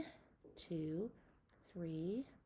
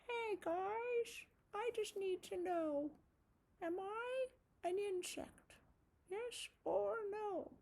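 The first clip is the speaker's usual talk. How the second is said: high, curious little spider; puppet spider voice; 10 semitones higher than usual. Acted. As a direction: squeak it as a friendly puppet spider. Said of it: "Spider would like to ask you".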